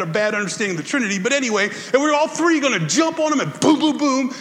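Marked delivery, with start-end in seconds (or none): 1.9-4.3 higher and louder, hammed-up boom boom; mock battle charge. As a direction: play it like a cartoon charge. Mocking triumphant God charging in.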